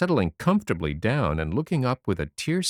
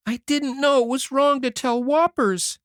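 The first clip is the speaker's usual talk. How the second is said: put-on high boyish voice breaking into sobs; a little boy crying his apology; sobbing; 4 dB louder than usual. A narrator's reading. Davy crying in Anne's lap, sobbing. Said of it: "he sobbed".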